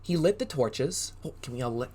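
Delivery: plain reading voice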